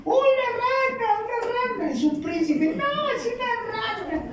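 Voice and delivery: very high, squeaky; squealing villagers. Acted. Frightened Egyptians squealing about frogs, very high pitched.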